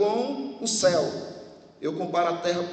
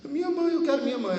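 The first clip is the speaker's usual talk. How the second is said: put-on crying child; 7 semitones higher, faster than usual. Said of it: "Aí ela disse".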